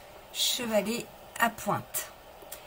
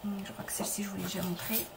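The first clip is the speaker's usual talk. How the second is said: whispered to herself, much softer; soft whispered note to herself; whispered aside; 3 semitones lower, 7 dB quieter, faster than usual. Real, not acted. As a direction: whisper it as an aside while checking. Muttered aside while sorting papers.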